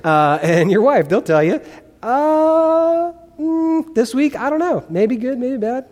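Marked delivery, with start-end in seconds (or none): 2.0-5.8 high, hesitant mock husband voice, pitched well up; unsure husband. Pastor mocks a husband asked about patience, high and hesitant. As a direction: play a husband dodging the question.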